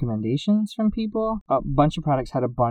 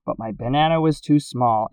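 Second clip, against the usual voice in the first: usual speaking voice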